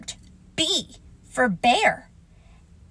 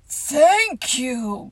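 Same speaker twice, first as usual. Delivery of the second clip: growled; 3 semitones higher, 4 dB louder than usual. Acted. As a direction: growl it gruffly with emotion. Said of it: "growled Pooh".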